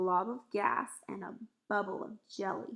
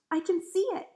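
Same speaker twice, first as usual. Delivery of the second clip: high, loud exclamation; excited cry; 9 semitones higher, 7 dB louder than usual. A narrator's reading. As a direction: cry out the discovery. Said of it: "cried out".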